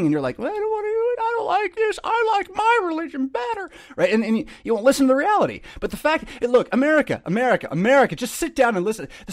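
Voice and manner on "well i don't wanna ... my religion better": much higher, mocking whine; whiny mock listener. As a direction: play the stubborn listener as a childish whiner.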